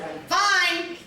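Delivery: high, firm retort; insistent. Retold or imitated. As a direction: push back hard on FINE. Irritated at being asked twice.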